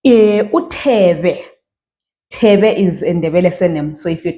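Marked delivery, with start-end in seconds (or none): 0.4-1.5 uThebe drawn out slowly; slow demo. Shown as a demonstration. Teacher introducing a surname, slowly. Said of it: "next word".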